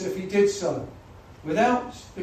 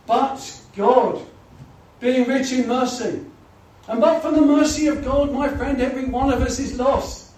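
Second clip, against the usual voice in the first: loud, ringing pulpit proclamation, higher pitched; preaching at full force; 6 semitones higher, 7 dB louder than usual. Acted. Preacher to the congregation about God's mercy, loud and ringing.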